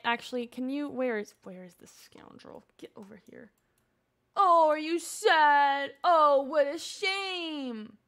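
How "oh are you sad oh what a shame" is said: slow, drawn-out fake sympathy; mock pity. Player taunting a sad game character, mock pity.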